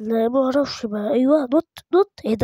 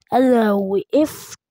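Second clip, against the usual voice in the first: slow; much slower than usual. Shown as a demonstration. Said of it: "تاني بقى".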